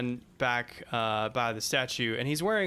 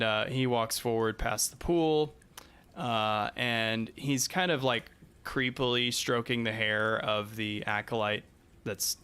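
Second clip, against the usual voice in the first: usual speaking voice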